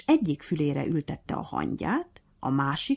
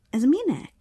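suspicious; 9 semitones higher, 5 dB louder than usual. A narrator's reading. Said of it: "kérdezte gyanakodva a majom".

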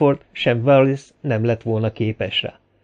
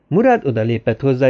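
ordinary delivery

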